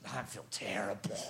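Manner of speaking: low, soft, lightly voiced sick man; a hint of a miserable sick friend. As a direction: croak it like a mildly ill guy. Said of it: "he goes".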